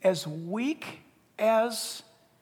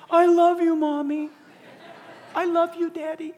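put-on higher, loud child voice; pleading child; 9 semitones higher, 6 dB louder than usual. A child telling parents it loves them after discipline, higher. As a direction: beg like a just-disciplined child. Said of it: "first thing out of their mouth".

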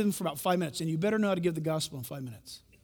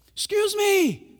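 high, slow, loud call for attention; 13 semitones higher, 6 dB louder, much slower than usual. Retold or imitated. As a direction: hail the crowd. Calling out to crowd in square.

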